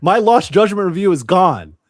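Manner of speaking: loud mock lament. Real, not acted. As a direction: mourn the lost review over the top. Lamenting a deleted review.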